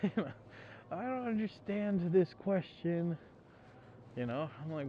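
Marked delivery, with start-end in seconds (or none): 0.0-3.2 mock whiny student. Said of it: "student quoted after laugh".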